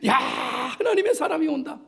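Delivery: admiring congregation. Acted. Congregation seeing the elder far off, admiring call. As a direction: call out as if spotting someone revered. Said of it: "장로님을 보면서 우리 성도들이".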